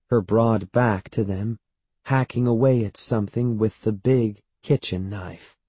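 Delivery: ordinary delivery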